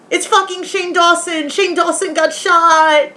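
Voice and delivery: mock-hysterical shocked exclamation; shocked outcry. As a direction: cry it out, aghast and high-pitched. Acting out shock at the death.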